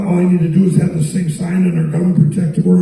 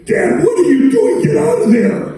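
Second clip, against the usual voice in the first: alarmed shout; 13 semitones higher than usual. Retold or imitated. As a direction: yell at dad to get out. Daughter shouting at her dad.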